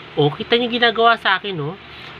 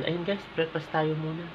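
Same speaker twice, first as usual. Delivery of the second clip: hushed voice; 12 dB quieter than usual. Real, not acted. Vlogger to viewers while the baby sleeps, speaking softly. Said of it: "medyo hinahinaan natin yung boses".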